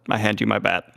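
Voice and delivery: ordinary talk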